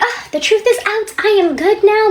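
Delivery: high, loud, elated imitation of a husband; mock relieved husband. Husband walking around after confessing, mock relieved. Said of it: "feeling great".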